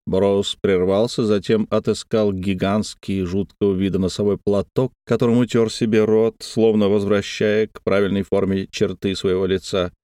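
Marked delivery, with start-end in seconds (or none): none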